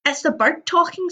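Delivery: high, bright exclaim of surprise; excited exclaim. A narrator's reading. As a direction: blurt it out amazed. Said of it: "Sid exclaims".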